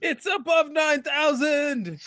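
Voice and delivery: slow, high dramatic shout; meme shout. Pretend. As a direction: yell it like an anime meme.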